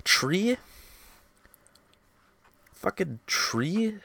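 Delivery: high, drawn-out incredulous repeating; exasperated, slow and high; exasperated disbelief. Real, not acted. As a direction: pitch the word up like it's absurd. Heated dispute over translating a word.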